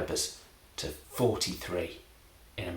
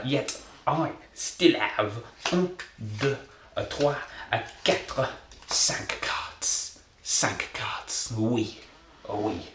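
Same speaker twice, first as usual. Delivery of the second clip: slow count in a mock French accent; slower than usual. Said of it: "I will be doing a French accent".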